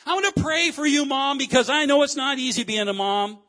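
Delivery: high, loud, insistent reassurance; fervent. Acted. Preacher offering to pray for a mom, fervent. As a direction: lift the voice and press it with fervor.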